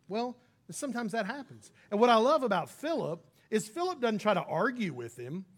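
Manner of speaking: normal talking voice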